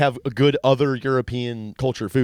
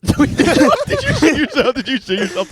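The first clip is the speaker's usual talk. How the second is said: high, slow, laughing question; laughing disbelief; 6 dB louder, slower than usual. Real, not acted. Hearing his friend's party accident, laughing high and slow. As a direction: crack up while asking it. Friend reveals embarrassing story.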